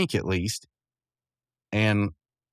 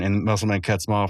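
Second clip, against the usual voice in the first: ordinary talk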